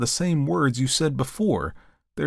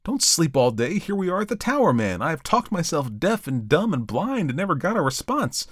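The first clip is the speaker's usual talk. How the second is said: shouted; 10 semitones higher than usual. A narrator's reading. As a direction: rouse him, excited and insistent.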